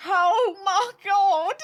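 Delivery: slow, high, loud wailing "Oh my God"; mock dramatic cry. Gamer voicing a sound effect, mock dramatic cry. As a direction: ham up a drawn-out horrified cry.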